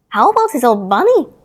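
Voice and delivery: Nana asking. A narrator's reading. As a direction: ask like a concerned nurse. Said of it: "Nana asked".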